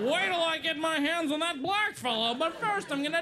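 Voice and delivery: very high caricature of a British-detective type. Acted. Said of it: "the one guy on earth who does talk like that".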